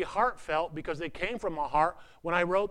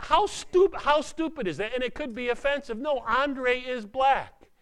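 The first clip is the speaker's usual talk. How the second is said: higher, emphatic preacher's outrage; higher excited protest; rising indignation; 7 semitones higher, 4 dB louder than usual. Real, not acted. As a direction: push it up, mock-indignant. Preacher heats up on racism.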